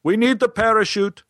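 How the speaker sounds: high-pitched mock yell; retold shout without extra volume. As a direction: call down through a trap door. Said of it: "he yelled down".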